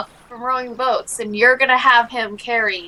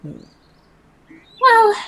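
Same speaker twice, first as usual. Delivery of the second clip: put-on lightly higher girl's voice; girl character; 10 semitones higher, 4 dB louder than usual. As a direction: voice the girl, brisk. Slavia voiced by player.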